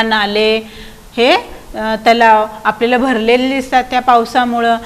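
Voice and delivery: normal talking voice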